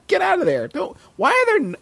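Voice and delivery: high-pitched indignant mock complaint; indignant tree. Tree scolding squirrels nesting in its mouth, indignant. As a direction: protest in a high, exasperated voice.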